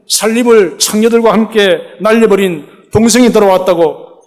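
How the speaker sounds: put-on older brother confronting his father over the feast, indignant; resentful older son. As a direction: hurl the complaint at the father. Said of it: "마음에 불평이 가득합니다".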